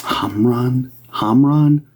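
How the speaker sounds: "Hamran" held high and even, HAHM-rahn; sounding out name. Shown as a demonstration. Medium repeating a spirit's name for viewers, "Hamran" sounded out high. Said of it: "it sounds like home run".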